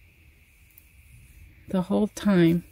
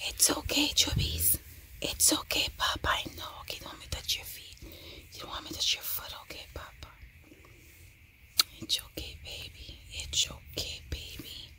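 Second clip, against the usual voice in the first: softly whispered comfort; 15 dB quieter, faster than usual. Real, not acted. Mom soothing dog during thunder, whispered. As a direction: hush everything for the scared dog.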